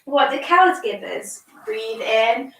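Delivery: slow, gentle yoga cueing; calm instructions for a small child; reading to a toddler. Acted. Guides a child through yoga poses.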